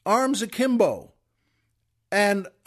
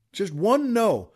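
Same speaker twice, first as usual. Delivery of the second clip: usual speaking voice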